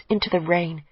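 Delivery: usual speaking voice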